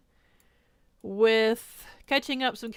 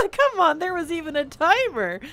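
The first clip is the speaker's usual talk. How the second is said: laughing; 8 semitones higher, 5 dB louder than usual. Real, not acted. Laughing at her own mistake.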